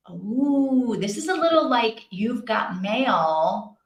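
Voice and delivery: usual speaking voice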